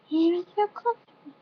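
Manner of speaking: little creature voice, pitched right up; high-pitched little skunk. A narrator's reading. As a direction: squeak it out like a small helpful skunk. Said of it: "and in a high-pitched voice".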